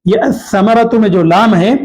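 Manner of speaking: ordinary talk, nothing marked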